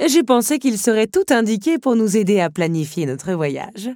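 grand countess making introductions; countess introducing. A narrator's reading. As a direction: present the guest with ceremony. Countess continues.

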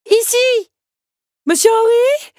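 high, loud calls through the orchard; calling out. Acted. Belle lost in the orchard calling her friends, high and loud. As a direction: shout to find them.

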